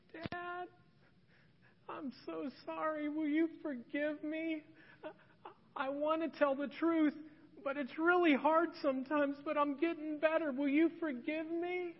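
put-on high, soft, tearful little-girl plea; crying child. Daughter confessing a lie to her dad, high tearful pleading. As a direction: imitate a weepy seven-year-old begging forgiveness. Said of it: "crocodile tears start coming down her face".

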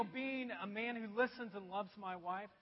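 ordinary talk

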